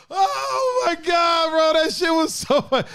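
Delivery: high, loud and laughing; half laughing, half shouting, pitched high; laughing exclaim. Real, not acted. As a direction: shout it while still cracking up. Laughing at own story.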